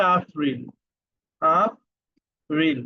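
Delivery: আফরিল said with an f sound, German style; আফরিল, slow German pronunciation; German April. Shown as a demonstration. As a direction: stretch আফরিল slowly, f in place of p. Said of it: "spelling same, কিন্তু প্রনাউন্সিয়েশনটা একটু ভিন্ন".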